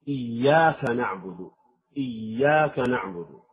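إياك بتشديد الياء ببطء; شدد ياء إياك وامددها قليلا; doubled ya. Shown as a demonstration. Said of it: "لا يعطي الياء شدتها". (تشديد الياء في إياك)

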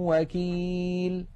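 وكيل chanted very slowly with the nasal hum carried from شيء; slow recited word. Shown as a demonstration. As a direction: hold the ghunna, then glide into وكيل. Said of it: "ومثال التنوين".

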